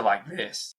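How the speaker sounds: a deflated "like this"; energy draining. Shown as a demonstration. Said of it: "I can feel the energy go from like this".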